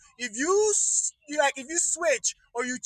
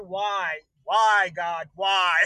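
lower, pleading groans to the heavens; mock exasperation at God; lightly voiced despairing senators; despairing Republicans; 7 semitones lower, much slower than usual. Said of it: "shaking their heads and going".

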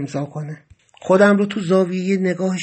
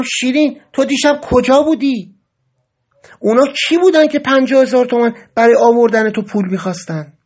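raised, pressing questions, a bit higher and louder; urgent demand; 5 semitones higher, 5 dB louder than usual. Acted. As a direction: confront her, demanding answers. Rushing at Shirin, demanding. (بازخواست تند و بلند)

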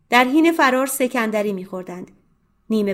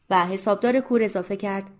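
plain reading voice

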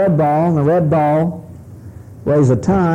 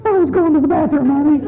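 high, quick timid husband voice; meek Charlie; 11 semitones higher, faster than usual. Retold or imitated. Charlie answering his wife meekly in a high, quick voice. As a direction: squeak it out, sheepish and eager to please. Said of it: "the guy starts to go".

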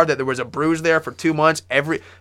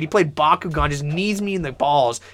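ordinary delivery

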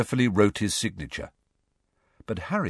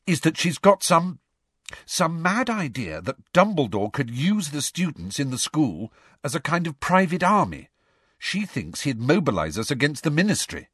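higher, earnest schoolgirl voice; explaining Hermione; 7 semitones higher than usual. Acted. Schoolgirl explaining the teacher's motive, higher and earnest. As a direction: lay out the theory, pitched up.

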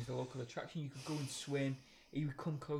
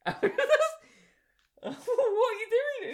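high, slow, laughing shriek of disbelief; shocked shriek; 9 dB louder, much slower than usual. Retold or imitated. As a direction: squeal it out, half laughing. Mate shrieks at dolphin mishap.

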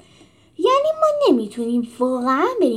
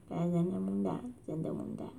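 fading echo; 4 semitones lower, 12 dB quieter than usual. Shown as a demonstration.

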